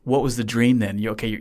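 normal talking voice

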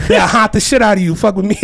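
loud, laughing brag about his crew; hyped boast. Retold or imitated. Bragging his ghost crew will haunt enemies, loud laughing. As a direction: shout it like a dare. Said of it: "That I was like".

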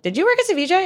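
high, urgent questioning; friend's question. Retold or imitated. Friend Doreen texts a question.

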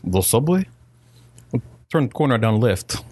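badly faked Russian; terrible Russian accent. Russian stranger giving subway directions, bad Russian accent. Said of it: "It's a terrible Russian accent".

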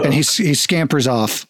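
normal talking voice

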